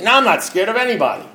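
proud bar-room bravado; defiant denial. Retold or imitated. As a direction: deny it like nothing could scare you. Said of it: "I'd say".

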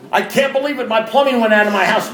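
high-pitched mock despair about the plumbing; mock exasperation. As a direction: ham up a self-pitying gripe.

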